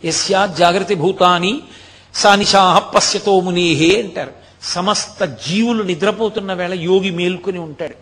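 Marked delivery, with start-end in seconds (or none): 0.0-4.0 slow, measured Sanskrit verse; scripture recitation. Retold or imitated. Preacher quoting the Gita to listeners, slow recitation. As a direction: recite the Gita verse slowly, pitch held even. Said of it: "భగవద్గీతలో అంటారు".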